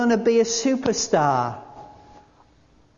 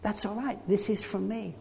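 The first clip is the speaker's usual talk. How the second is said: softer, near-whispered comfort; God's gentle reassurance; 11 dB quieter than usual. Pretend. Preacher voices God calming the anxious, hushed and tender. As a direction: hush them like God calming a worried heart. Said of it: "God says".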